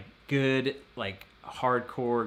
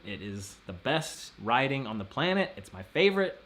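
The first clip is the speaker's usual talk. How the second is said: ordinary delivery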